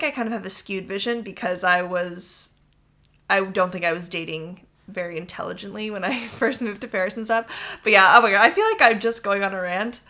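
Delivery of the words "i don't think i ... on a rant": ordinary delivery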